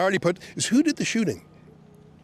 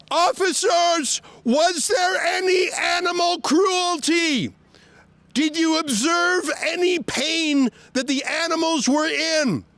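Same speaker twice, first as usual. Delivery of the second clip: shouting at officers; 9 semitones higher than usual. Real, not acted. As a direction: make the officers hear you. Shouting questions at police.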